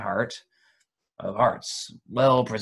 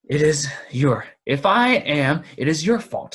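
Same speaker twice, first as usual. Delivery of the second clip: angry; 4 semitones higher, 6 dB louder than usual. Acted. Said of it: "said Bilbo".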